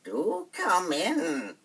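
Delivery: very slow, high, sugary invitation; sweet witch voice. Acted. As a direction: lure them in sweetly, dragging the words. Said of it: "spoke very sweetly".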